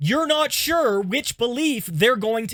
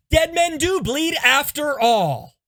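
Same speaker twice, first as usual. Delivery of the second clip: high, louder astonished realization; mock revelation, pitched up; astonished discovery; 6 semitones higher, 3 dB louder than usual. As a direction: announce it as a stunning discovery. Patient reverses his belief.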